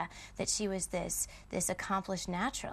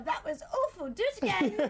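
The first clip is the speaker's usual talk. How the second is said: higher, louder mock dialect coach scolding; imitating strict dialect coach; 7 semitones higher, 4 dB louder than usual. Actress imitates her dialect coach scolding her take. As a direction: play the stern coach cutting her off. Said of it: "I knew Miss Berkery right here to go".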